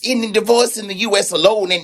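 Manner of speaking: Patty voice. Acted. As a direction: scold him like a sassy invisible sidekick. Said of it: "Hush, Patty".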